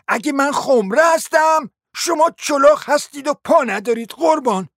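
high, indignant scolding; affronted lady. Acted. Noblewoman attacking the prince for insulting her, scolding indignantly.

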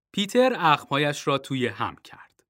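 plain reading voice

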